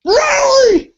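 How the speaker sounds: very high, loud disbelieving shout; exasperated high Really; incredulous yell. Retold or imitated. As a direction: scream your disbelief. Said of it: "I'm like".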